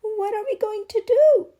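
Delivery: high, loud, panicked cry; mother crying out at her daughter's worsening illness; desperate cry. Acted. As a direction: cry out in alarm for your child. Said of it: "cried Missus Kareem".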